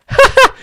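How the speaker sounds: high loud burst of laughter; delighted laugh. Real, not acted. Amazed the trick worked.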